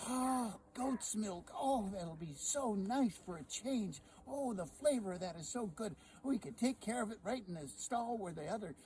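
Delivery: wife dreaming aloud of goat's milk; dreamy wife. Acted. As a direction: savour the thought of goat's milk. Sally praises the goat.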